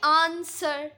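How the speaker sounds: Answer with आंस stretched and सर short; long आंस, quick little सर in answer; stress on first syllable. Shown as a demonstration. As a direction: lean on the first part of answer. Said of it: "आंस को हम लोग बड़ा सा खींचते हैं".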